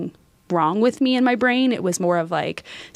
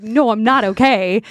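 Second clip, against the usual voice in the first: emphatic no; 3 semitones higher, 6 dB louder than usual. Retold or imitated. Retold emotional reply to professor.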